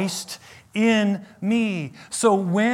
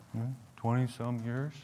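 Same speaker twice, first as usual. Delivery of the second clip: quiet reply; 8 semitones lower, 12 dB quieter than usual. Retold or imitated. Pastor's own reply retold.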